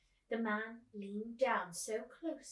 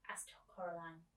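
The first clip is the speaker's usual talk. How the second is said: plain reading voice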